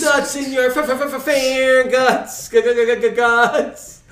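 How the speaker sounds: high, loud looped stutter; stuttering DJ parody. Pretend. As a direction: shout it like a DJ scratching a record. Echoes it as stuttering DJ.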